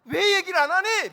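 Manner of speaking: high loud scolding yell; scolding shout. Retold or imitated. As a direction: yell at the child for hiding it. Parent scolding crying child. (아이에게 큰 소리로 꾸짖는 부모)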